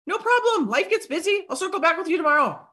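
high, breezy reply; sing-out no-worries voice, quickening at the end; cheerful reassurance. Acted. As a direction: brush off the apology brightly. Modelling cheerful reply to apology.

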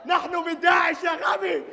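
loud shouted rebuke in formal Arabic; exasperated shout. Acted. Militant reminds his stupid partner who they are, shouting. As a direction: yell it at a fool.

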